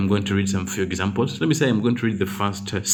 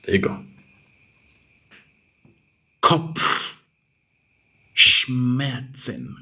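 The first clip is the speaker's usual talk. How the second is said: "Kopfschmerzen" stretched very slowly, each sound apart; drawn-out spelling voice; 4 semitones higher, much slower than usual. Shown as a demonstration. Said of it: "check the spelling for Kopfschmerzen".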